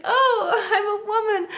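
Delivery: high, loud, laughing exclamation; gleeful delight. Retold or imitated. Traveler reacts to admiring men, gleeful delight. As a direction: squeal it as a playful, delighted realization.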